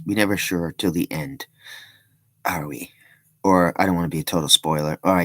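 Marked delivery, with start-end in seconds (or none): 2.4-2.9 whispered aside. Real, not acted. Aside after a spoiler.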